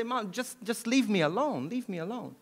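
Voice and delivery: normal talking voice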